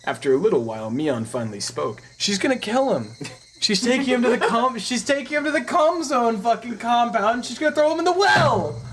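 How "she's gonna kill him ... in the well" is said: rising excited squeal. Real, not acted. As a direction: go higher and louder as it builds. Excited rant about the plot.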